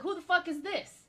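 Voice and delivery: high-pitched mock disbelief; retold bafflement. Viewer seeing a forgotten character pop back up, retold bafflement. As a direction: pitch the question up in exaggerated confusion. Retells own baffled reaction to screen.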